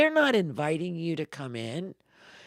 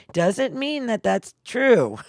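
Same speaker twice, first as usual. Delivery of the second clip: emphatic; 7 semitones higher, 10 dB louder, slower than usual. Real, not acted. Emphatic claim, loud and slow.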